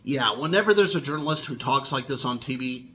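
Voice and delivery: rattled off quickly like a TV reporter; fast journalist talk. Shown as a demonstration. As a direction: speed through it like a hurried newsreader. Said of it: "talks so fast like a journalist".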